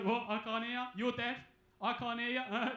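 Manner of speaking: loud jeering "can't hear ya"; mocking deaf joke. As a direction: lay on the mockery, loud and dumb. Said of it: "You know".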